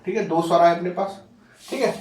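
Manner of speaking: ordinary delivery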